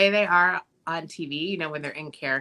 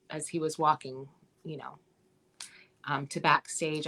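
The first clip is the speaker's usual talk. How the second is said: usual speaking voice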